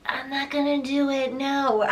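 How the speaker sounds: lightly whiny refusal, laughing. Pretend. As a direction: whine the refusal back half-laughing. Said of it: "whine my response back".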